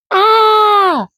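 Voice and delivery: sudden high yell. Acted. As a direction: burst out yelling.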